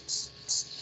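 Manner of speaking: soft voiceless "ts, ts" hiss; whispered ts of a lisp. Shown as a demonstration. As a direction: demo an unwanted lisping ts sound. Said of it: "el famoso ceseo".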